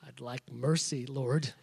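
hushed and humbled, asking mercy; sheepish plea. Retold or imitated. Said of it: "What would you like me to do for you".

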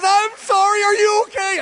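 high, loud worried cry; retold anxious shout; frantic wife. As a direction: play the panicking wife. Wife still panicking.

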